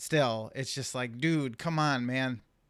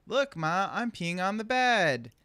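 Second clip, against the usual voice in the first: high, gleeful sing-out, stretched at the end; happy little kid; 6 semitones higher, slower than usual. Retold or imitated. Son to mom from the bed, happy little kid. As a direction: imitate a proud child announcing it to mom. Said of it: "Just happy as could be".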